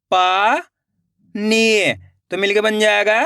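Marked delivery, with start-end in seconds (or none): 0.1-1.9 पानी split into पा, long pause, नी; rising पा, then falling नी; syllable by syllable. Shown as a demonstration. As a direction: sound out पानी slowly in two parts. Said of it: "एक एक अक्षर का उच्चारण करना है".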